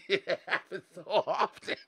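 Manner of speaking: riendo mientras lo dice; laughing. Real, not acted. Laughs while saying it.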